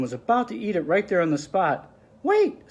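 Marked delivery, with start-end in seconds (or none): 2.2-2.7 very high, small mouse cry; squeaky mouse voice. Acted. Mister Mouse warning Ganesha, high squeak. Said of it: "squeaked Mister Mouse".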